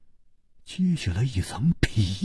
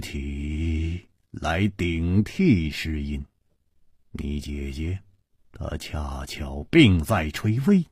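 low, soft, measured explanation; slowly; 6 semitones lower, 4 dB quieter than usual. A narrator's reading. Chu explaining why her sister was chosen, slowly. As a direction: draw it out slowly with long pauses. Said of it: "缓缓地说".

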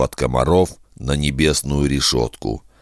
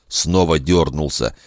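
plain reading voice

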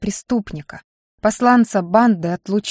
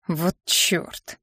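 softer, disappointed muttered oath; disappointed hiss; 2 semitones lower, 7 dB quieter than usual. A narrator's reading. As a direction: let it out softly, deflated. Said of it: "разочарованно прошипел Баринов".

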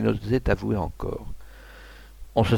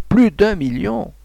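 plus d'un million again, slow and much higher; higher slow cry; 9 semitones higher, slower than usual. A narrator's reading. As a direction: climb up in triumph. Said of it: "Il criait". (crié lentement, bien plus aigu)

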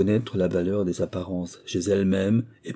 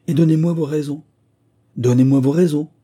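loud, high, insistent demand; exclaimed demand; 7 semitones higher, 7 dB louder than usual. A narrator's reading. Squire badgers Moïse for reasons, crying out loudly. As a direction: hammer out the demand, then repeat it. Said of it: "s'écria le squire".

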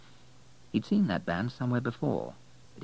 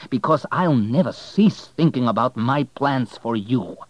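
menacing threat; 6 semitones higher, 10 dB louder than usual. Acted. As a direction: promise revenge with venom. Parting threat to Kane.

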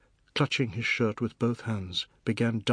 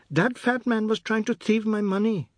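put-on high-pitched child's accusation; complaining little boy; 11 semitones higher, 4 dB louder than usual. Anand reports the fat man to father, high-pitched complaint. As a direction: tell on someone in a high boy's voice.

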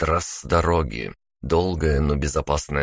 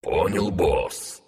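slow, muffled reply from under water; underwater voice; much slower than usual. Acted. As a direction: answer as if through water. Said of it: "звучал он не лучше, чем сам Артур".

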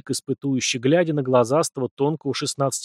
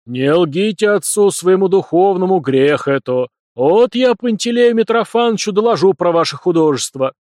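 loud, higher stern rebuke; scolding priest; 5 semitones higher, 7 dB louder than usual. Acted. Priest threatening to report them to the director: loud, stern. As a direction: rebuke them with churchly severity.

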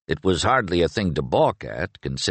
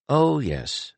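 pensive; 7 semitones higher than usual. A narrator's reading. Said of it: "said Inglis pensively".

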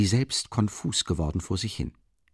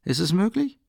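surprised; 7 semitones higher than usual. A narrator's reading.

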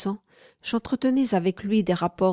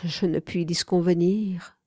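plain reading voice

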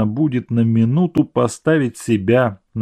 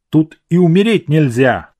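louder, scornful exclamation; contemptuous; 5 semitones higher, 7 dB louder than usual. A narrator's reading. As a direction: spit it out with contempt. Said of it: "сказал он презрительно".

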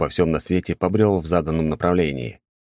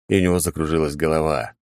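ordinary delivery, nothing marked

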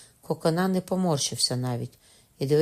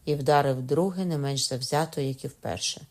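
ordinary delivery, nothing marked

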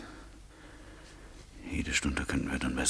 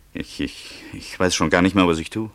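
louder and higher, overwhelmed; passionate outburst; 4 semitones higher, 10 dB louder than usual. Acted. Laurent swearing his love to Therese, overwhelmed. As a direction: burst out with helpless passion.

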